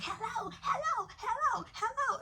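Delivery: very high, slow, sing-song hello; a shrill demanding auntie into the phone. Retold or imitated. Auntie grabbing the hidden phone, high drawn-out hellos. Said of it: "she took the phone and she like".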